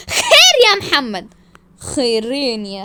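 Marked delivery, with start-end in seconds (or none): 0.1-1.4 loud, high, slow cry; outraged demand. Acted. Wife confronts Mohamed, crying out in outrage. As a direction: stretch it out indignantly.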